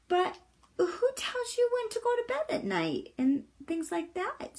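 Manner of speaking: put-on high, soft girl's voice; Annika. A narrator's reading. Annika's question in the story.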